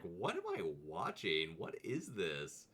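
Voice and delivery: a lightly voiced confused viewer. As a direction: play the bewildered viewer. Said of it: "everyone's like".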